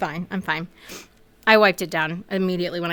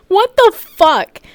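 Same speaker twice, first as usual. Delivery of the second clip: higher, slow, stunned disbelief; shocked; 11 semitones higher, 6 dB louder, slower than usual. Real, not acted. Reacting to the grim dinner plan in stunned, slow disbelief. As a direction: draw it out in outrage.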